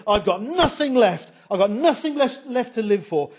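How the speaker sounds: high, loud, pleading complaint as Micah; high plaintive protest, half laughing; whining Micah. Acted. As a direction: wail like a man robbed of his gods. Micah voiced complaining, preacher acting.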